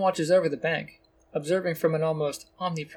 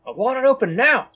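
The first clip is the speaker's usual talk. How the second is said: angry demand; 9 semitones higher, 8 dB louder than usual. A narrator's reading. Robber boss demands vault opened.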